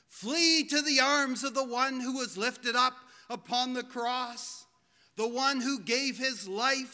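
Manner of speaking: impassioned altar-call preaching; fervent preaching. Acted. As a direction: plead with the congregation to come.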